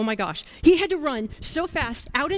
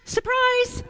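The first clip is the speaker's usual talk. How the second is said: high-pitched storyteller's surprise; mock surprise; 11 semitones higher, 4 dB louder than usual. Storyteller reveals what Saul pulls from his pocket, in high mock surprise. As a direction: pop it out high and sudden.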